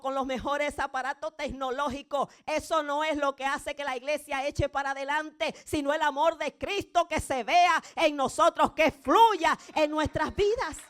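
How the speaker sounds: loud, rising sermon at full force; fiery preaching. Acted. Pastor urging the church on, full-force preaching.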